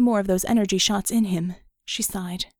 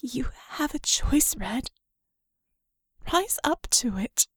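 soft, breathy whisper, much softer; mere whisper; 3 semitones higher than usual. Acted. Vicky whispering to Red after her voice breaks. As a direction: drop to an intimate whisper after the crack. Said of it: "softer, a mere whisper".

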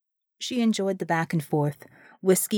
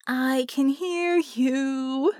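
over a speaker; 5 semitones higher, much slower than usual. Acted. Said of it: "voice came over sound system".

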